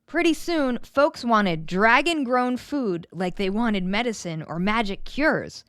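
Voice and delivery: ordinary delivery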